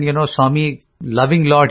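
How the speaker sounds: ordinary delivery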